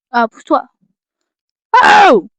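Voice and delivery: irritated push-away, then a loud exasperated oh; real irritation at a pet or object; agacée, puis un oh fort; annoyed snap. Real, not acted. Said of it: "Pardon, je m'énerve".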